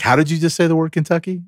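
high, quick mock disbelief; retold incredulous ribbing; incredulous question. Friends teasing his pronunciation, retold.

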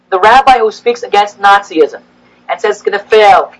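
ordinary delivery

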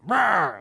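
loud high mock roar of rage; dad raging at his son in the car, mock roar; angry roar. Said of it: "like, angry, so angry".

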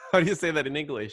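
asks through a laugh; giggling, quick and breathless. Real, not acted. Both laughing over a word.

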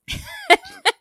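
high snort of laughter. Real, not acted. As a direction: let a snort out mid-laugh. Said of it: "did you just snort".

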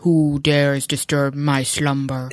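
slow booming cave voice; lightly voiced cave guardian, slow; unearthly voice. Quoting the Cave of Wonders.

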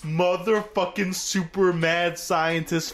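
slow, drawn-out emphatic outburst; worked-up retold reaction, hitting every word; incredulous ranting. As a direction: stretch each word in amazed disbelief. Retells his incredulous viewer reaction.

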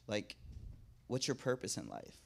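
low, hushed casual opener; quietly sidling up to a stranger; low-key street approach. Retold or imitated. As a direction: drop it low and soft, with pauses. Acting out walking up to strangers.

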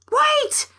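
high yelled cry, pitched far up. Acted. Fretful cat calling the leaving beast back, high yell. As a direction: call out sharply to stop them leaving. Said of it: "yelled a cat".

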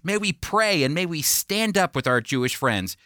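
normal talking voice

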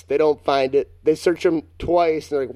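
ordinary talk, nothing marked

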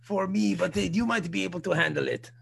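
fussy French waiter; put-on comic French accent. French waiter warning about the hot pepper, French accent. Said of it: "That's my French accent".

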